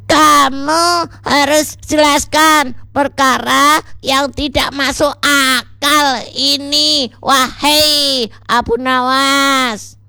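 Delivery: high, drawn-out indignant character voice; angry demand. A narrator's reading. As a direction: demand an explanation, stretching the last words. Angry Sajid demands explanation. (menuntut dengan marah, suara tinggi)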